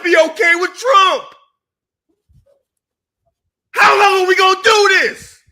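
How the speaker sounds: building to an exasperated yell; angry rant. Real, not acted. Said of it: "Yes, I'm snapping on you".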